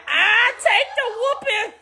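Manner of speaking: put-on high, laughing kid answering back; a little girl mouthing off to her mom, high and laughing; defiant kid voice. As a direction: play the cheeky child taking the punishment. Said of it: "I used to be like".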